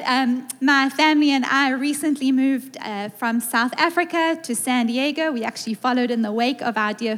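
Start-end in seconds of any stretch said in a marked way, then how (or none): none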